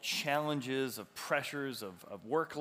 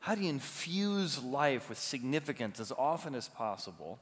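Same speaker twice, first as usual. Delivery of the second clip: ordinary talk, nothing marked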